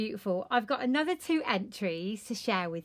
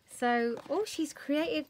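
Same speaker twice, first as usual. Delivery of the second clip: normal talking voice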